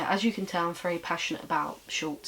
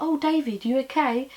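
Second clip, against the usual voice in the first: higher-pitched imitation of nan; nan on phone; 7 semitones higher, 4 dB louder than usual. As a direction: play nan greeting a grandson, pitched up. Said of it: "She'd be like".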